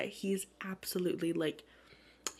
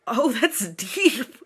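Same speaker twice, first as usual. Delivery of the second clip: drawn-out, louder, laughing reply; laughing reaction; 3 semitones higher, 9 dB louder, much slower than usual. Retold or imitated. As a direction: stretch it out through a laugh. Amused reaction voiced as heroine.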